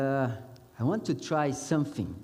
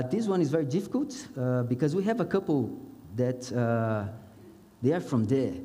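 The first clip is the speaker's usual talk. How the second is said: normal talking voice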